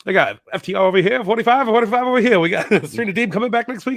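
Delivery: fast, high, loud auctioneer patter. Retold or imitated. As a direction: rattle it off like an auctioneer. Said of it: "he sounds like an auctioneer".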